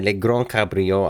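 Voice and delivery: put-on slow, amateur French accent on "Le Grand Cabrio". Host muddling Italian car names, "Le Grand Cabrio" in French accent. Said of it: "then the French kicks in".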